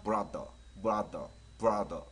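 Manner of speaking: 브라더 as one joined word; three sounds blended. Shown as a demonstration. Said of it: "세 개 섞어서 하면".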